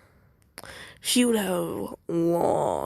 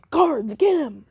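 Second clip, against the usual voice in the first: calling the guards; 9 semitones higher, 6 dB louder than usual. Acted. Lord calls guards after losing.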